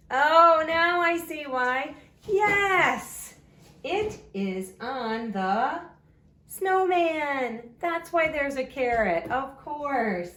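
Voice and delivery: high, loud, hammed-up delight; big excited discovery for the children; playful sudden realization. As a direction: burst out as if just figuring it out. Teacher acts out discovering the snowman.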